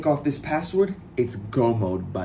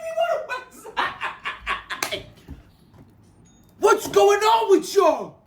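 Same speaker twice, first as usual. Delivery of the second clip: very high, shouting and laughing in disbelief; ecstatic shouting. Real, not acted. Streamer watching site traffic explode, ecstatic shouting. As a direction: yell out every new number. Visitor count keeps climbing.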